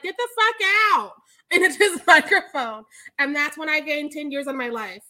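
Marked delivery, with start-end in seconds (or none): 0.0-1.1 higher, louder mock yell into a mic; Bruce Campbell yelling. Imitating Bruce Campbell shouting at the heckler, higher and louder. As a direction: boom it out like a star on stage. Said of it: "is like".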